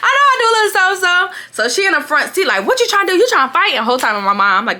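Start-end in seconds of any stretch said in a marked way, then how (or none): 0.0-1.4 squeaky, high mock bravado; playing it cool. First-time fighter bluffing, playing it cool. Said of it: "I'm trying to play it cool". 2.7-3.7 high-pitched goading questions; mock challenge. Friend in front seat goading her, mock challenge.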